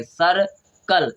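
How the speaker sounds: "सर्कल" split slowly into sar, pause, kal; slow teaching split of "सर्कल"; syllable-by-syllable. Shown as a demonstration. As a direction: pull "सर्कल" apart with a gap before kal. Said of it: "तो सा पढ़ेंगे".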